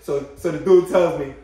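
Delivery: ordinary talk, nothing marked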